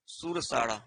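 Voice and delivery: सुर साड़ा said as the everyday spoken form of स्वर; बोलचाल वाला उच्चारण सुर साड़ा; local pronunciation. Shown as a demonstration. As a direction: demonstrate सुर साड़ा the way people say it colloquially. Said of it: "इसमें सुर साड़ा भी बोलते हैं ना".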